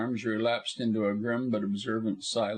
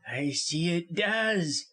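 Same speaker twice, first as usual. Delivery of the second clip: slow, drawn-out, higher-pitched admission; measured, higher character voice; grudging concession; 5 semitones higher, slower than usual. A narrator's reading. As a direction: draw it out reluctantly. Busham reads the will, answers.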